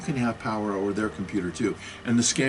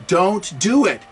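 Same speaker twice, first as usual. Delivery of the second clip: louder, slower warning; firm raised voice telling viewers not to; 5 semitones higher, 5 dB louder, slower than usual. Real, not acted. Warning viewers against scammers, raised voice. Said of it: "Sorry, I raised my voice".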